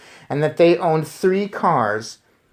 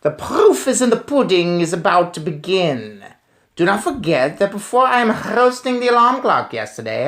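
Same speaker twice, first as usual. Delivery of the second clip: higher, excited witch; eager gloating witch; 4 semitones higher, 3 dB louder than usual. Acted. Grand High Witch speech, read aloud.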